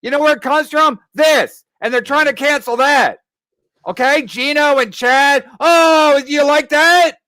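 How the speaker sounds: loud, high-pitched tirade aimed at Ray; angry yelling. Real, not acted. Host yelling at Ray about the show money, furious. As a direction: berate him, voice rising and loud.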